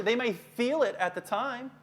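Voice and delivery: ordinary delivery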